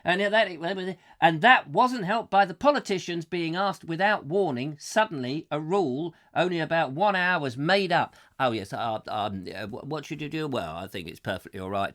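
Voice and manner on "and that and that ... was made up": loud, high, hammed-up tirade; raised-voice mock outrage, easing slightly as it goes; read-out rant. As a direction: read it as an indignant ranter in full flow. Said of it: "continues with his rant".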